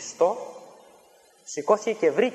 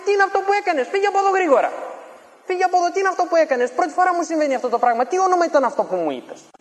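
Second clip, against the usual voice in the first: high, loud, rushed fearful outcry; panicked alarm; 5 dB louder, faster than usual. Retold or imitated. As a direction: chase him off in sudden panic. Sorcerer panics after losing power. (πανικόβλητος μάγος, δυνατά και γρήγορα)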